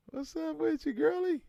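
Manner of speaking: high squeaky put-on voice; playful falsetto greeting. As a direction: greet her in a cutesy high voice.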